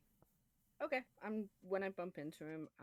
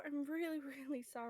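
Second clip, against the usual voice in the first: higher, hammed-up worried apology; over-the-top apology; 8 semitones higher than usual. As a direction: gush it a little too much. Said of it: "make it a bit over the top".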